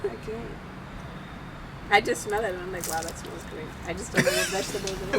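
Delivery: right in your ear. Real, not acted. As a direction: close to the mic, near whisper. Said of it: "Why you do it so close to me".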